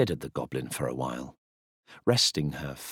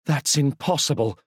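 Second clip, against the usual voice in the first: high, louder outraged queen; indignant high shout; 9 semitones higher, 5 dB louder than usual. Acted. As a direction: snap it back at the goblin. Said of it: "she shouted".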